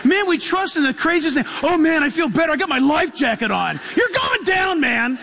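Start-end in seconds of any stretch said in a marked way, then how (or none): 1.6-3.8 smug passenger. Retold or imitated. Passenger trusting life jacket. 4.0-5.2 laughing shout. Real, not acted. Mocking the sinking passenger.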